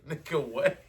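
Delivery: ordinary delivery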